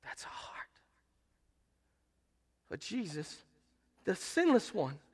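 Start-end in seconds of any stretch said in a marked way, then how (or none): 0.0-0.8 a hushed whisper. Real, not acted. Preacher hushes to a whisper to make the point. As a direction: breathe it out under your voice. Hushed remark after the quote.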